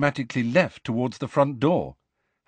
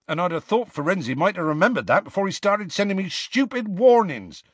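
high-pitched, heated complaint, louder at the end; hot, indignant; 10 semitones higher, 4 dB louder than usual. A narrator's reading. Hagrid resents the warnings from Firenze, hotly. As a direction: burst out indignantly, building in volume. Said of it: "he added hotly and unexpectedly".

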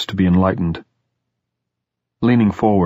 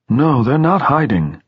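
put-on elderly headmaster; 7 semitones higher than usual. A narrator's reading. Head's dialogue in story.

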